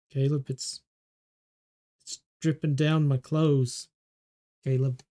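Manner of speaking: a hurt man staring at his blood; dazed wounded doctor. Acted. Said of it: "he's like".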